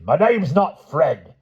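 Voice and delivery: high, slow insistent denial; firm denial. Acted. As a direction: deny it with every word. Wilby denies.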